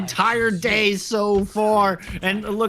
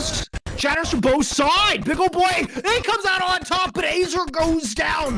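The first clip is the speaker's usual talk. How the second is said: esports caster; 7 semitones higher than usual. Acted. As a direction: call the fight at full hype. Live play-by-play peak.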